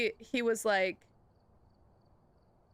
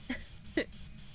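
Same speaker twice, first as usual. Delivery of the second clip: wheezing laugh; 9 dB quieter than usual. Real, not acted. Laughing at chat.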